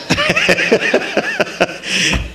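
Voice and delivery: a high breathy laugh. Real, not acted. Speaker laughing at his own faucet joke, high laugh.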